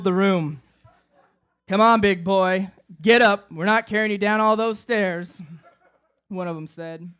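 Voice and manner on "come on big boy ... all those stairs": loud, no-nonsense command; gruff order. Retold or imitated. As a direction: boss him up like a tired paramedic. Paramedic ordering him up.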